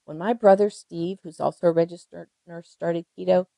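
ordinary talk

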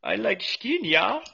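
high, hammy broken English; mock foreign accent. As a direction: squeal out very good.

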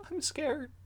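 put-on very high, soft small-boy voice; a frightened little boy; scared child. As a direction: Fifth tells the hero he is scared. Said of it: "big, watery squirrel eyes, and says".

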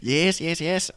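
high, loud Southern coach approving; mock Southern coach praise; Southern coach accent. Coach praising students in a hammed-up Southern accent. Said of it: "a slight Southern accent".

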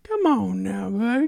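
high cooing granny voice; grandma voice. Acted. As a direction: coo to the baby like grandma. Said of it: "grandma voice".

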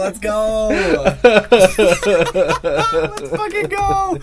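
high, laughing victory shout; excited cheering. Real, not acted. Correct trivia answer celebration.

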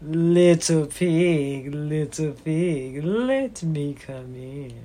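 low, slow, sly wolf. Acted. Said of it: "came the wolf and said".

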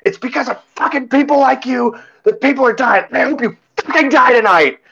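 very high, strained imitation of screaming; mock furious rant. Drunk-seeming but sober lady blames him, mock screaming rant. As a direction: shriek it like an unhinged accuser, played for the story. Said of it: "she's losing it".

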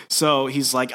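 usual speaking voice

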